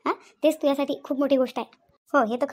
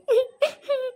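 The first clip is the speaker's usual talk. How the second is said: choked laugh-sob; 8 semitones higher than usual. Acted. Wife breaks down mid-complaint.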